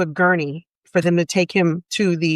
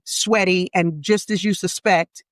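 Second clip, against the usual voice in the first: ordinary talk, nothing marked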